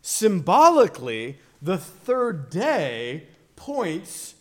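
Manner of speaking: high, loud sermon exposition with weighty pauses; emphatic preaching. Real, not acted. Preacher expounding the third day at Cana, emphatic preaching. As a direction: drive each key phrase home to the congregation.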